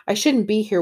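ordinary talk

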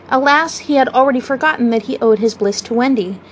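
ordinary delivery, nothing marked

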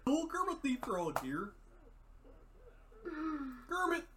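a hint of the frog puppet; Kermit impression. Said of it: "I used to be able to do Kermit".

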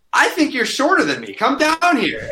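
high mocking taunt. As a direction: needle him, voice way up. Retold taunt to heckler.

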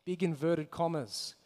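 ordinary delivery

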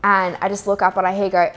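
ordinary talk, nothing marked

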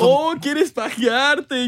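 high, drawn-out teasing exclamation; hammed-up slow tease; mock shock. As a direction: stretch it out in goofy mock scandal. Teasing repeated in skit.

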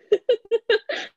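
shrill high laugh burst; high cackle. Real, not acted. Laughs at own quote.

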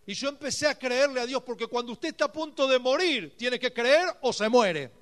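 a pastor raising the fervour; fervent preaching. Real, not acted. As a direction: build the testimony with conviction. Preaching at full force.

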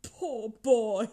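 pitying. A narrator's reading. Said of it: "in pity for his former self".

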